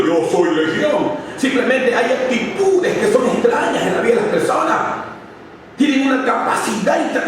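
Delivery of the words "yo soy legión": demon voice. Acted. Preacher voices the demon's words.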